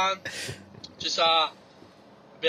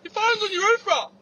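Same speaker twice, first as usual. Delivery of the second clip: loud shouted warning across a car park; calling out; 6 dB louder than usual. Retold or imitated. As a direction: yell it from the bus stop. Junkie yelling from bus stop.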